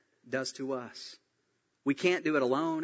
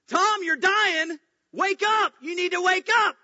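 very high, loud, drawn-out shout; all-out yell; 8 dB louder than usual. Acted. Bill kneels and yells in Tom's face, all-out yell. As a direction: yell as loud as you can. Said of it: "yell as loud as you can".

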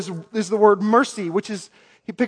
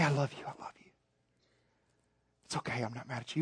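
put-on much softer, hushed comfort to a child; tender dad; 9 semitones lower, 18 dB quieter, faster than usual. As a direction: hold him close and murmur it gently. Dad hugging son after spanking.

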